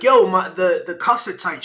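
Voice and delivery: loud pained protest; loud complaint. Retold or imitated. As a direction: complain loudly that the cuffs hurt. Complaining about cuffs, retold.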